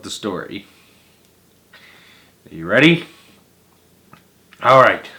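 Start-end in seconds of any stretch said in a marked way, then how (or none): none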